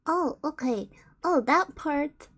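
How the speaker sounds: usual speaking voice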